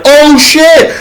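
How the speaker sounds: high, loud mock alarm. Zambrano reacting to the birth, loud mock alarm. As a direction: blurt it out in shock. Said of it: "Zambrano's like".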